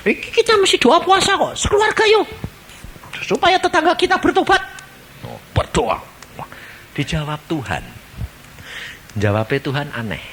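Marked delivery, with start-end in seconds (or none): 0.1-4.6 eager family call. Retold or imitated. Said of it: "Terus dia doa".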